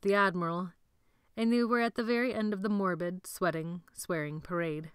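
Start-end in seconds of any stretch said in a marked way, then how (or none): none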